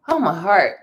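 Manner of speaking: loud, full "Oh, my heart"; sports fan overcome by joy; heartfelt cry. Real, not acted. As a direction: clutch your chest and call it out. Eagles heading to Super Bowl.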